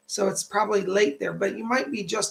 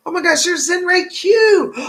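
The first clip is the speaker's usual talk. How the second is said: delighted surprise; 10 semitones higher, 10 dB louder than usual. Real, not acted. Spots a donor in chat.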